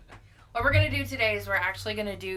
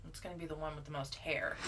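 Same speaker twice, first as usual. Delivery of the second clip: normal talking voice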